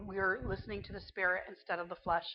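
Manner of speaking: ordinary talk, nothing marked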